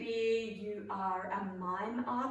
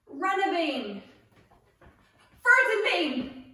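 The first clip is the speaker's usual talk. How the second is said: loud, high game command; called out; 7 semitones higher, 9 dB louder than usual. Shown as a demonstration. Leader calls the next bean to the players, called out loud and high. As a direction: belt it out and let the pitch drop. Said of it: "if I call out frozen bean".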